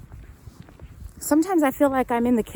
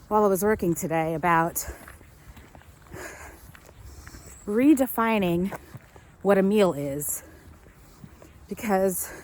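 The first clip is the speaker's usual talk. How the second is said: out of breath; 2 semitones lower than usual. Real, not acted. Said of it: "sorry, I'm out of breath".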